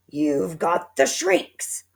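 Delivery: slow loud accusation; cried slowly. A narrator's reading. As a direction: point and pronounce doom. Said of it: "cried Mister Twit".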